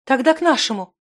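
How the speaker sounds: high, urgent voice; instant urgent reaction. A narrator's reading. Zimana suggests another healer at once, urgently. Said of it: "тут же отреагировала Зимана".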